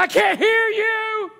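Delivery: high, loud, drawn-out call; wife calling out. Acted. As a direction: imitate a wife hollering from afar. Wife calls from afar.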